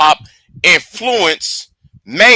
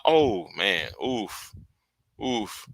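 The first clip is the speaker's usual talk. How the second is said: much lower and softer oofs; stunned oof; 9 semitones lower, 14 dB quieter, much slower than usual. Real, not acted. Streamer still reeling from her savage line, hushed. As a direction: let the words fade out stunned. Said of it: "reacting to the burn".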